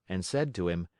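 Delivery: ordinary delivery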